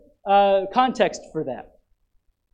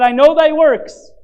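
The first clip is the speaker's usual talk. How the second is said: loud, slow, weighty declaration; Jesus quoted loudly; 8 semitones higher, 10 dB louder, slower than usual. Retold or imitated. Preacher quoting Jesus to the congregation, loud and slow. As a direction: proclaim each word slowly and loudly. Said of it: "He said".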